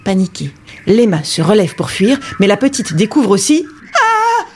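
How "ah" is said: frightened cry. Acted. Girl sees the animals.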